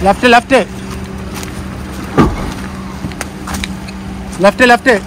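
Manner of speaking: calling out. Real, not acted. Shouting directions to driver.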